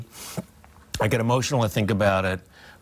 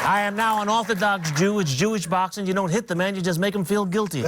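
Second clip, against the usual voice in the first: higher boastful champ voice, lightly done; light Ali impression, higher and cocky; Muhammad Ali; 10 semitones higher than usual. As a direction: brag to the room like the young champ. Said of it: "really good Ali imitation".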